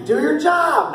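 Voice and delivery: sharp high shout of Do your job; yelled order. Retold or imitated. Teammate yelling at players, high shouted order. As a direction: bark it like a teammate on the field. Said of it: "He yells at you".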